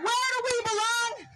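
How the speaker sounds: shouted call to the crowd; rally call. Real, not acted. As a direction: throw the question out to the crowd. Call and response.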